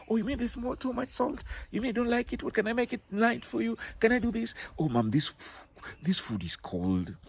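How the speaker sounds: politely easing a complaint about salty food to mom; gentle tactful request. Acted.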